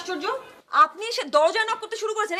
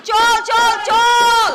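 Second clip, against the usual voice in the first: rising shouted call, louder on each চল; loud stage shout; 4 semitones higher, 7 dB louder, much slower than usual. Acted. Actor rehearsing over the neighbour's noise, shouting. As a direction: belt it out to drown the noise. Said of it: "কিভাবে rehearsal করা সম্ভব".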